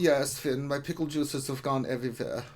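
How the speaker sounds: comic German accent, playing along. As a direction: answer in a put-on German accent. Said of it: "in your German accent".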